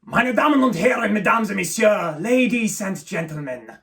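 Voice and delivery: put-on high, loud German-accented emcee; big theatrical trilingual welcome; showman's opening monologue. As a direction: announce it grandly like a cabaret MC, stretching the last words. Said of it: "his opening monologue is a really iconic monologue. It goes".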